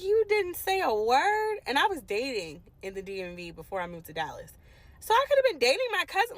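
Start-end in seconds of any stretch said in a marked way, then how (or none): 0.0-1.6 high, slow, shocked question; stunned disbelief. Retold or imitated. To her mother, a high stunned slow question. Shocked she kept the secret. 5.0-6.4 horrified realization. Real, not acted. Realizing she dated nearby.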